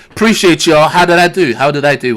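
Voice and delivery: loud, hammed-up American accent; a Brit trying hard at American, pleased with himself. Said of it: "they throw in your American accent".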